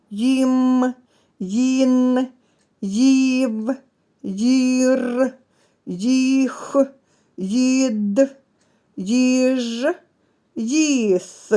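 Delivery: each syllable "Їм", "їн", "їв" said slowly and apart; syllable drill. Shown as a demonstration. Teacher models syllables for the class, slow and clear. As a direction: read the syllables one by one, clearly. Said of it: "Складемо склади з цією буквою".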